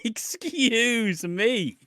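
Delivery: slow, laughing excuse me. Real, not acted. Laughing at the show's line, excuse me said slow through laughter.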